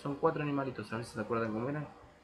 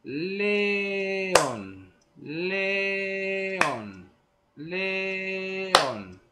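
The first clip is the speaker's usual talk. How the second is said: león held high and loud, le-ón; syllable rhythm; 6 semitones higher, 5 dB louder, much slower than usual. Shown as a demonstration. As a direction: roar out león in two long beats. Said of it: "que va a ser así".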